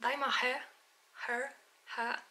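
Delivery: trying out British pronunciations of "hair"; faltering French speaker's British accent; attempted British accent. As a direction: test "hair" in an amateur British accent, word by word. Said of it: "genre britannique".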